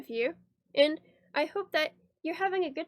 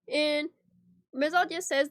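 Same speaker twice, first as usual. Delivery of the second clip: ordinary talk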